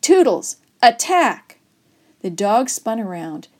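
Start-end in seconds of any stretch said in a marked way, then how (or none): 0.0-1.6 high, loud order to a dog; storybook battle cry; attack command. A narrator's reading. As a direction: call out sharply, setting the dog on them.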